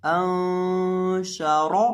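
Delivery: अंशारा chanted very slowly, the noon hummed nasally and the रा full; ghunna on noon. Shown as a demonstration. Teacher showing students how the word should sound, slow nasal ghunna. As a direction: stretch अंशारा with a long nasal ghunna in a sung tone. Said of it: "इसकी आवाज़ आएगी".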